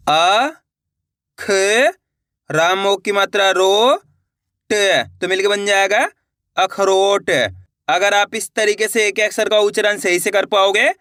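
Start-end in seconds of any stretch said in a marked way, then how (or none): 0.1-7.3 slow, careful syllable dictation; slow spelling. Shown as a demonstration. Teacher sounding out a word for students, slow and careful. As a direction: sound out each syllable slowly, then blend. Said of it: "चलिए देखिए".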